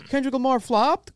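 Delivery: "Kendrick Lamar flopped?" pitched high, rising like a question; high mock-headline lift up to "flopped?"; clickbait question. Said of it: "Question mark. Clickbait".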